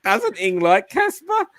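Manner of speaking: high, drawn-out mock-puzzled question. Retelling Becky's confusion to friends, high mock-puzzled voice.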